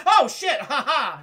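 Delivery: loud, high yelp into laughter; mock startled. Prisoner finding an investigator in his cell, mock startled. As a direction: play caught out, laughing it off. Acting prisoner caught by visitor.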